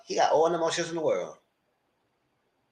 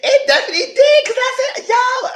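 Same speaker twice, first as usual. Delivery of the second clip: high, loud squeal through laughter; loud high-pitched amused outburst; laughing excitement; 6 dB louder than usual. Real, not acted. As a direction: exclaim it high, still cracking up. Excited, laughing recap of twist.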